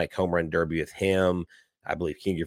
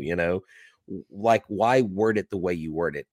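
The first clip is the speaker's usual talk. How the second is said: ordinary talk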